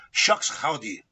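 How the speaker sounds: a touch of Chicano on "Shucks, howdy"; Chicano accent. Shown as a demonstration. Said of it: "was like a Chicano".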